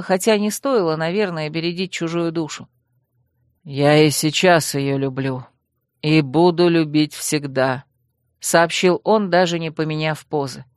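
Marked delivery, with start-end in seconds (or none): 3.6-7.8 low, slow voice of a lord; low-voiced lord declaring lasting love; lovelorn dragon lord. A narrator's reading. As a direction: drop low and speak with slow, steady conviction.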